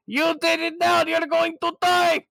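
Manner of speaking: high, loud panicked yell; screaming in terror at the tank; mock horrified shout. Russians seeing the fire, a high panicked shout.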